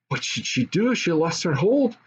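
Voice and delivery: ordinary delivery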